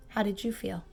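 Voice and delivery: plain reading voice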